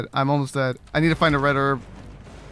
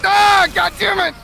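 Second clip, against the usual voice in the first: high, drawn-out angry cry; frustrated yell; 7 semitones higher, 3 dB louder, slower than usual. Real, not acted. Player cursing at the game, frustrated high yell. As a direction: cry out and curse, slow and high.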